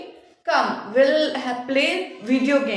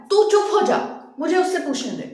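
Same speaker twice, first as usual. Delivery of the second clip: angry scolding; faster than usual. Acted. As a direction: shut the son up sharply. Mother-in-law scolds her son. (बेटे को ज़ोर से डाँटना)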